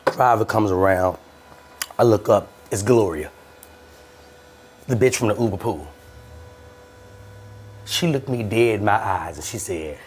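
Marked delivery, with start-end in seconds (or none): none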